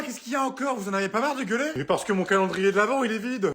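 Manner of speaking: normal talking voice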